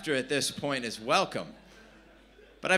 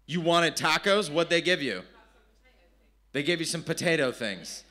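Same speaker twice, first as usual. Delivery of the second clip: usual speaking voice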